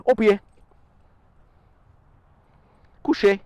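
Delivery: dog command. Real, not acted. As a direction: snap the commands out crisply. Giving dog commands in French.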